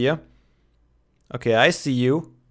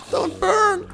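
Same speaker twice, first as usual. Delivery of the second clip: high pleading voice; 3 dB quieter than usual. Pretend. Pleading with a burning villager.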